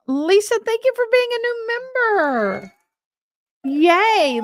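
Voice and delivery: high, drawn-out celebratory thank-you; excited cheer. Real, not acted. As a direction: cheer the new member, pitch way up and held long. Cheering a new member on stream.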